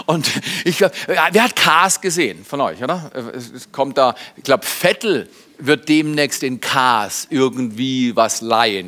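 ordinary delivery

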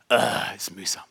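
tief, leise aufgestöhnt; mühseliges Stöhnen. Retold or imitated. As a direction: gespielt geplagt seufzen. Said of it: "mein Denken war". (gequält nachgespielt)

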